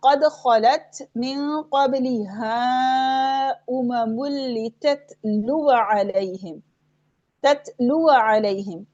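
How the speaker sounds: slow tajweed recitation with "ततलुअ अलैहिम" run together; joined recitation. Shown as a demonstration. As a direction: recite slowly, no long alif in "ततलुअ". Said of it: "वसलन भी नहीं पढ़ रहे".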